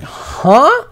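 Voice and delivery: sharp high confused huh; a startled, disbelieving yelp of a question; baffled huh. Retold or imitated. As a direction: caught off guard by the offer. Confused reply to doctor's offer.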